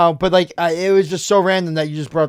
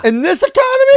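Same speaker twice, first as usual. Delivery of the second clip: very high, loud incredulous squeal; mock amazement; 5 dB louder than usual. Friend selling the lunch deal's price, mock-astonished. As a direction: shoot the voice up in disbelief.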